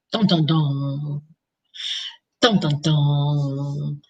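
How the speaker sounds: slow, low dramatic dun dun dun; mock suspense. Real, not acted. Teasing viewers about secret releases, slow mock suspense. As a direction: sing out a mock suspense sting.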